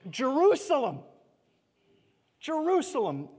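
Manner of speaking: lament. Acted. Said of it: "And then he says this".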